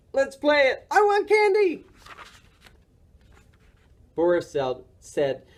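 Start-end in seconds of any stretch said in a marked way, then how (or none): 0.1-1.9 put-on high, eager childlike character voice; excited. A narrator's reading. Morris begging Boris to play for candy, excited. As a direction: squeal it with greedy eagerness.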